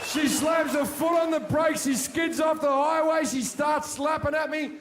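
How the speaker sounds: mock-panicked shouting tale; frantic loud retelling. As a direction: yell the chaos out as it builds. Frantic retelling of car fight.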